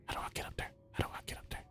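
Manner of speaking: hushed whisper while sneaking around. Real, not acted. Gamer sneaking through a quiet level, whispering. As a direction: keep it a scared whisper. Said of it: "Why are you whispering?".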